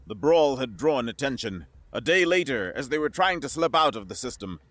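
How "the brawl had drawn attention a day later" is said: ordinary delivery, nothing marked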